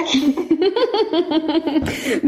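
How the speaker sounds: real rising laugh. Real, not acted. Host laughing at the caller's quip about qaf. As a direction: laugh up and away.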